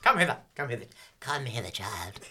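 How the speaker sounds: lightly voiced witch beckoning; a hint of a creepy hag calling. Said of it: "that's my hag voice".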